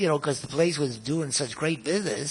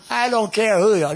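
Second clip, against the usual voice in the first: louder, higher snap from the manager; angry manager; 5 semitones higher, 6 dB louder than usual. Retold or imitated. Hotel manager yelling back at Serge, louder. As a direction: bark it back at him. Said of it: "manager retort".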